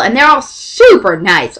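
ordinary delivery

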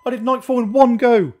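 high, loud shouts of triumph; ecstatic celebration. Real, not acted. Player beating night four in one go, ecstatic. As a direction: shout it out high, thrilled with the win.

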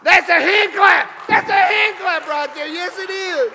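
loud, high preacher's shout; whooping up a round of applause; exultant shout. Real, not acted. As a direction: shout it out to rouse the congregation.

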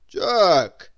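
loud stern call of the name; teacher calling a daydreaming pupil; teacher's sharp call. A narrator's reading. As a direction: snap him out of it.

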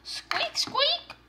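very high squeaky bunny; one piercing little bunny squeak; chocolate bunny squeak. Pretend. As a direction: squeak like a toy rabbit. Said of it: "saying squeak".

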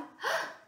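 sharp storyteller's gasp; startled gasp. Acted. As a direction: gasp in mock surprise. Gasp as bears near home.

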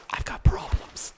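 slow, hushed whisper; whispered aside. Pretend. Player arguing with himself, slow whisper. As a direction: whisper it as a private confession.

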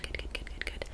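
ordinary talk, nothing marked

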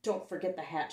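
ordinary delivery, nothing marked

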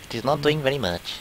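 put-on Russian accent. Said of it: "talk in that fucking Adventure Time Russian accent".

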